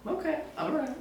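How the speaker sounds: high, squeaky "okay, all right"; high, squeaky mock alarm; mock startled. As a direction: play it up as a high, startled yelp. Reacting to surgeon's casual plan.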